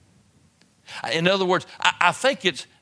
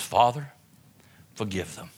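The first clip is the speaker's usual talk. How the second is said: tender plea; 6 semitones lower than usual. Acted. Preacher repeats Jesus' prayer for his killers, hushed and tender. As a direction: ease it out gently, full of mercy.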